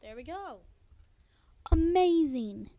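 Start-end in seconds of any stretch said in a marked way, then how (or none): 1.7-2.7 loud, slow, stretched amazing; drawn-out amazed. Real, not acted. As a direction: savor the word, loud and slow. Admiring his built house.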